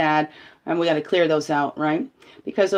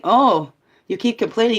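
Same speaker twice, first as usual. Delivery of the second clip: voice of the universe; 6 semitones higher, 7 dB louder than usual. Acted. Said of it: "the universe will say to you".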